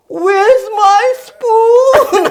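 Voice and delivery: high, slow, strained squeak; mock big lady. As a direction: stretch it out in a silly high squeal. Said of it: "expression is like".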